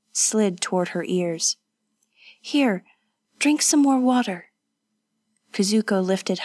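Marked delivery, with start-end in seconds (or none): 2.4-4.5 higher-pitched, lightly voiced woman; caring companion. Companion offering water to the wounded, caring. Kazuko nurses her injured friend.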